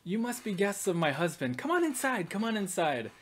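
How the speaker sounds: a hint of a welcoming noblewoman; the Baroness. Baroness greeting her guests, lightly voiced and gracious. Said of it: "And she goes".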